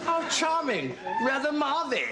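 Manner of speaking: high, hammed-up upper-class English accent. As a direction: put on an affected English accent, pitched high. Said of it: "and have an English accent".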